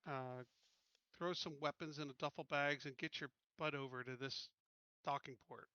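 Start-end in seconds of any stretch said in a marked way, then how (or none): none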